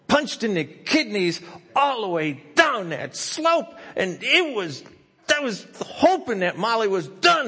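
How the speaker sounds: high, loud, strained and slow; pained strained telling. Retold or imitated. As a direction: sound like every bump still hurts. Painful bumpy toboggan ride.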